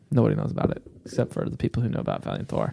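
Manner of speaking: hushed, fast whisper. Real, not acted. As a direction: whisper it like a secret. Secret subway, whispered aside.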